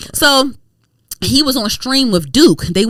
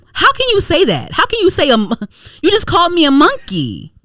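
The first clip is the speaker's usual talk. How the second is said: high, emphatic indignant retort; offended, raised-pitch comeback; 6 semitones higher than usual. Retold or imitated. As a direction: push the pitch up, appalled at the accusation.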